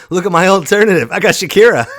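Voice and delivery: high, loud laughing brag; real giddy laughter; laughing boast. Real, not acted. Laughing boast about [name].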